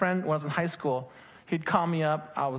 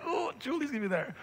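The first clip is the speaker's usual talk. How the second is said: excited crush; 9 semitones higher, 5 dB quieter, faster than usual. Retold or imitated. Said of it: "Trust me, I was like".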